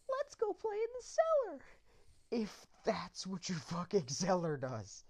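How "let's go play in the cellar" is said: put-on squeaky high child's voice; creepy kid voice. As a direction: play a cheery little kid. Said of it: "to be like".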